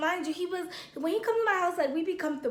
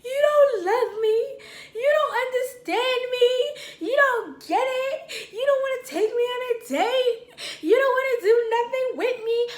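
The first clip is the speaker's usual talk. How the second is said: mock crying; 9 semitones higher, 5 dB louder than usual. Drunk girlfriend complaining to boyfriend, hammed-up crying. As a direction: imitate a drunk tearful complaint. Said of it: "and I'm crying".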